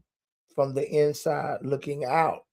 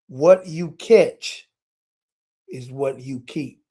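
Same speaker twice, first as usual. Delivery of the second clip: slow, deliberate; slower than usual. Shown as a demonstration.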